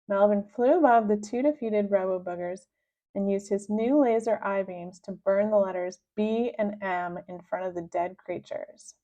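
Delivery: usual speaking voice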